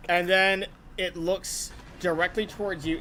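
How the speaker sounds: ordinary talk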